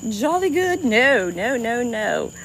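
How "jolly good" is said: put-on playful, high-pitched English accent; mock English accent. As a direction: put on a joking British accent. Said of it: "You're from England, right?".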